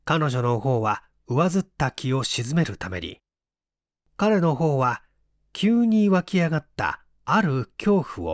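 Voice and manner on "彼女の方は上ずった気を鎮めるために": ordinary delivery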